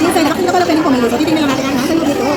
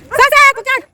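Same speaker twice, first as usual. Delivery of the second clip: calling out; 12 semitones higher, 4 dB louder than usual. Real, not acted. Calling out to a dog.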